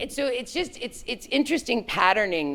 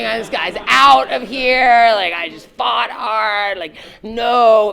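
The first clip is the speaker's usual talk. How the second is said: loud, high, slow indignant thought; irritated inner outburst; 8 semitones higher, 9 dB louder, much slower than usual. Retold or imitated. Boss reacting in her head to raise demand, loud. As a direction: fume at the ungrateful hire.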